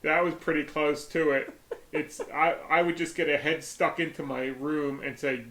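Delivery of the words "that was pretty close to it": ordinary talk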